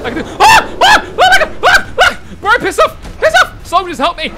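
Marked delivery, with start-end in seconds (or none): 0.4-2.4 very high, loud startled screams; panicked scream. Real, not acted. Panicked screaming during gameplay. 2.4-4.3 high-pitched, loud panicked yelling; panicked shouting. Real, not acted. Attacked in game, calls for help.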